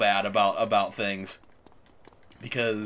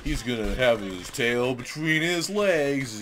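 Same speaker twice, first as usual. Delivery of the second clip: lightly voiced gloating teens, slowing down; a hint of smug taunting teen; mocking kids' voice; 3 semitones higher than usual. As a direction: mimic the smug kids who think they won. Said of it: "you know".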